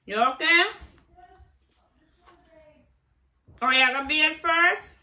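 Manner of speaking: loud, high questioning call. Real, not acted. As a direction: call across to check on him. Calling out to check on Jamar.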